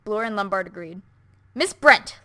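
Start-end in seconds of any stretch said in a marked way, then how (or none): 1.5-2.2 louder calling out; yell. A narrator's reading. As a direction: shout her name as they enter. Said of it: "Lombard yelled".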